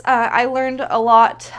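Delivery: normal talking voice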